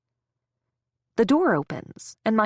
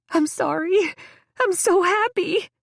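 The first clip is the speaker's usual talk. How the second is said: high, loud gushing; overjoyed; 10 semitones higher, 4 dB louder than usual. A narrator's reading. Friend gushing at the patient, high and overjoyed. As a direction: gush with teary joy. Overwrought friend apologising tearfully.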